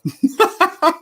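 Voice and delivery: high-pitched burst of laughter. Real, not acted. Teasing about no offense.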